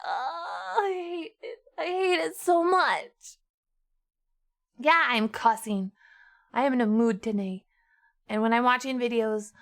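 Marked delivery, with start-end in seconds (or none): 0.1-3.4 high, slow, hammed-up whimper; fake-sob for effect; mock crying. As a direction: melodramatic pretend weeping. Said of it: "over-exaggerating for effect".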